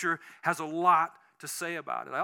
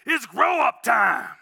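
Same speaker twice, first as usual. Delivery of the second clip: slow booming yell, much louder and higher; military shout; 5 semitones higher, 11 dB louder, slower than usual. Retold or imitated. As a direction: bark it like a drill sergeant from the pulpit. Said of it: "he just yelled this".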